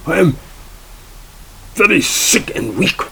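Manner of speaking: hammed-up German plea of weakness; German accent. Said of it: "in a very strong German accent".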